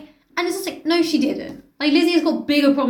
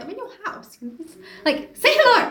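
indignant; 9 semitones higher than usual. Retold or imitated.

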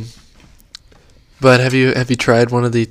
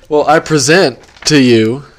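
announcer voice; 9 dB louder than usual. Pretend. Grand reveal of the topic.

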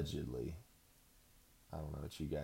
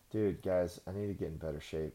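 usual speaking voice

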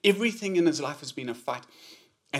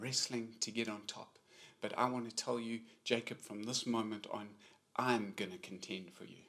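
tender voice of Jesus; 4 semitones lower, 7 dB quieter than usual. Acted. Jesus tells Jacob he will fight for him, softly reassuring. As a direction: lay the fight down for him gently.

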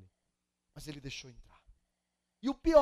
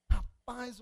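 ordinary delivery

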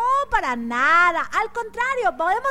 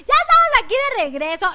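excited; 9 semitones higher, 7 dB louder, much faster than usual. Real, not acted. Host returning on air.

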